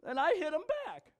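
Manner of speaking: high-pitched fake blubbering; hammed-up childish wailing; mock crying child. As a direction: imitate a tearful kid pleading his case. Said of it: "look at their crying child and say".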